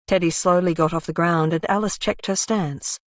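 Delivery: ordinary delivery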